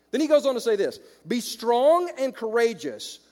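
loud, high preaching read of the verses; preaching scripture. Acted. Preacher reading God's words to Joshua to the congregation, loud and proclaiming.

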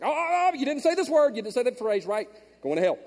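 hammed-up religious fretting; mocking a rigid believer, high and loud; mock alarmed legalist. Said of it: "he's like".

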